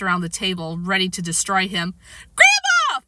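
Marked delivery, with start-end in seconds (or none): 2.3-3.0 high, loud cry for rescue; Fudge. A narrator's reading. Fudge calling Grandma as Peter comes at him, crying out. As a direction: yell for help at a high pitch. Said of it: "Fudge cried".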